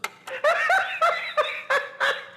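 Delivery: a high mechanical fairground laugh; sailor dummy laugh. Acted. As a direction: play the laughing sailor's cackle. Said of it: "and he laughs at them".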